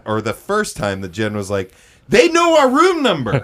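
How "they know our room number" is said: high, loud mock panic; hammed-up alarmed partner; freaked out. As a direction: wail it in exaggerated alarm. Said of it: "she was freaked out".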